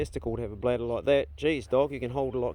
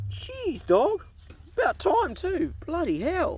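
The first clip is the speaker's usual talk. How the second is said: very high-pitched amazed exclamations; astonished relief; slower than usual. Real, not acted. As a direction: let out high, drawn-out disbelief as it finally ends. Dog finally finishes peeing.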